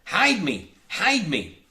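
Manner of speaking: high, loud panicked plea; hoarse terrified cry. A narrator's reading. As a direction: beg the crew to hide you. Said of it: "crying to his men hoarsely".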